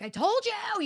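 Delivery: high, singsong I-told-you. Retold or imitated. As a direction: rub it in playfully. Mom answering kids.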